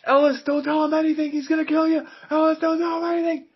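high, urgent pleading; pleading movie quote. Retold or imitated. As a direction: beg him not to talk, high-pitched. Quoting Die Hard scene.

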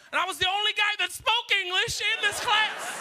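indignant punchline in a high voice; comic incredulity. Acted. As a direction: pitch it way up in mock exasperation. Comic outrage at ESL class placement.

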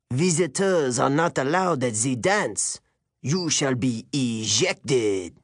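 put-on stern French-accented teacher, drawn out at the end. A narrator's reading. Male teacher orders visitors out in a French accent. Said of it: "He had an accent. French, maybe".

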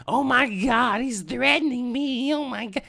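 mocking a panicked woman, high and slowed; a hammed-up shriek of fake terror; mock hysterical. Comic mocks the woman in Central Park losing it. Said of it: "she's going fucking berserk".

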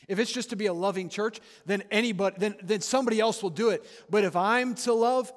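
loud, driving pulpit voice, pushing every phrase; a fired-up preacher's exhortation; preaching at full force. Acted. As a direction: preach it hard and call them to act. Rising close of the sermon.

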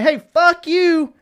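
high, slow, punchy mock retort; mock defiant retort. Person who was insulted answers back, a mock defiant retort. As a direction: spit it back slowly at them. Acted comeback in a retold scene.

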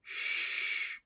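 long soft "shh"; shushing. A narrator's reading. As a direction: hush them with a drawn-out hiss of air. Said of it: "said Dipple".

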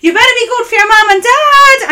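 high, loud scolding call; stern shouted warning. Retold or imitated. Aunt scolds her nephew outside school, shouting high. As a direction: bellow it at the boy like a fierce aunt. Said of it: "like this".

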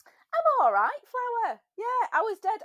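high, loud cheery hello; bright loud greeting. Real, not acted. Greeting her co-host, high and loud. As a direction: burst in loud and upbeat.

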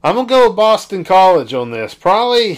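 loud and emphatic; emphatic loud pick. Real, not acted. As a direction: declare the pick loudly and firmly.

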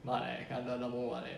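low, soft voice-changer growl; lightly put-on deep macho voice; disguised deep voice. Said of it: "ボイスチェンジャーでやってる".

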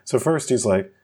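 ordinary talk, nothing marked

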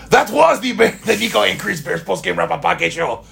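high-pitched hammed-up French accent; mock French accent. Host signing off the podcast, in a mock French accent. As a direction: sign off as a cartoonish French announcer. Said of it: "doing French accents for everybody's voice".

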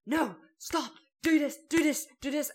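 loud, high barrage of orders; demanding. Retold or imitated. Man ordering his helpers about while they work, demanding. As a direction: imitate a bossy man firing off commands. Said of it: "he was just so demanding".

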